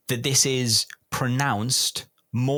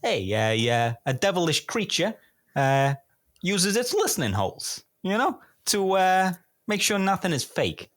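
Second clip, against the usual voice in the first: put-on higher-pitched over-the-top American accent, reading the clue; 6 semitones higher than usual. Host reads the clue to a player in an American accent. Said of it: "Do you want any particular accent".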